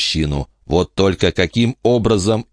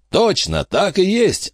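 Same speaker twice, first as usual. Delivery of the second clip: Robbie. A narrator's reading. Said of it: "вмешался Робби".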